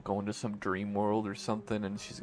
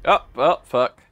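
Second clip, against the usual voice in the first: dismayed outburst; 4 semitones higher, 17 dB louder than usual. Real, not acted. Sudden bad turn in game.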